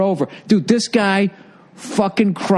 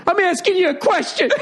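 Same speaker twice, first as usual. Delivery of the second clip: high-pitched mock outburst; worked-up emotional owner. Owner presses Gordon for an answer, high mock outburst. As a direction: imitate a man erupting before tears. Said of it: "He starts crying".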